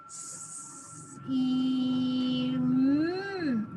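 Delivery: sounds "S- e- mm" one by one; slow blending. Shown as a demonstration. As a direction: stretch each sound apart. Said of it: "let's blend them together".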